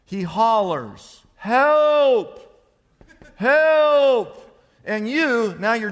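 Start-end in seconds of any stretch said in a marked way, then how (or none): 1.4-4.6 loud, high, drawn-out cry for help; hollering for help. Acted. Man sinking in quicksand hollers for rescue, loud holler. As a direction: holler it long, as if to someone far away. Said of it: "he hollers at the top of his lungs".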